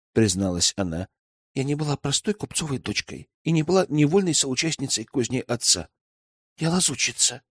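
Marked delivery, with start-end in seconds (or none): 1.5-7.4 Веслава confessing. Acted. Merchant's daughter admits to elder she is a spy, confessing. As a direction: confess in a restrained girlish voice.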